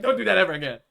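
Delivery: loud acted telling-off; mock angry scolding. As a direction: imitate a friend's angry warning, loudly. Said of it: "He gets really angry when".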